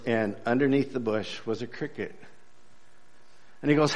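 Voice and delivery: usual speaking voice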